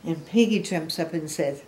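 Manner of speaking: ordinary delivery, nothing marked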